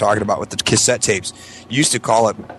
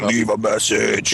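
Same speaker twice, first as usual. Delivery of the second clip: slow, eerie drawn-out voice; spooky greeting; much slower than usual. Retold or imitated. [name] greeting on the machine, in a slow eerie drawl. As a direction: drawl it like a horror host.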